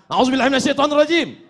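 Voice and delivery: hurried angry recitation. Shown as a demonstration. Preacher showing how an angry man seeks refuge, fast and rushed. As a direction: gabble it out in a temper. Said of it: "Kalau sedang marah".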